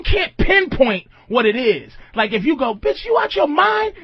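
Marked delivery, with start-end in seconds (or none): 2.7-3.9 much higher, hammed-up husband yelling at wife; high mock outburst. Husband confronting his wife, high mock outrage. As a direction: play the husband's outraged shriek.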